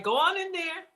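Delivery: imitating a hungry customer at the cafeteria; urging cafeteria server. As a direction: egg the lunch lady on. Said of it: "you're like".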